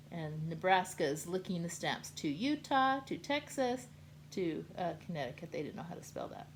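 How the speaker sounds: plain reading voice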